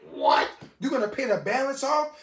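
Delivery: acted-out astonishment, voice lifting at the end; incredulous. Storyteller reacting to the boss's offer to pay, incredulous.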